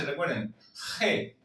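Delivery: ordinary delivery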